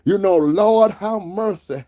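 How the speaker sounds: loud, drawn-out Southern preacher wail; exaggerated Southern pulpit cry; mock Southern preacher. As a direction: ham up a Southern preacher, high and slow. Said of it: "Trying to sound like a Southern preacher".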